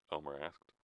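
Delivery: plain reading voice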